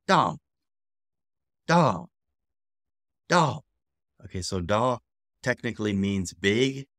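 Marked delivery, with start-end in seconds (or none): 0.1-3.6 short loud DA falling from the top. Shown as a demonstration. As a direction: say DA high and let it fall, fourth tone. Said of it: "it is a falling tone".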